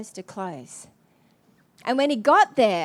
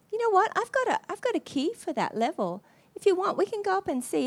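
lady's cheerful offer; 8 semitones higher than usual. Retold or imitated.

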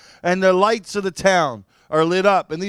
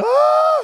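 high, loud startled scream; shocked scream; 6 dB louder than usual. Retold or imitated. Floating in the sky, he looks down and screams in shock. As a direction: cry out in sudden fright.

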